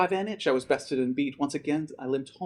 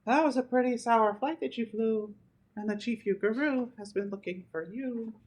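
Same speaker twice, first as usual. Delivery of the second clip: a touch higher, teasing majorette; lightly voiced majorette; 8 semitones higher, 4 dB quieter than usual. Drum majorette greets the returning boy, lightly higher teasing. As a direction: tease him gently about the flight.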